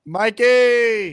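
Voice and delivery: high, loud call of the name; host hailing a caller on a bad connection. Real, not acted. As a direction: call his name across a bad line.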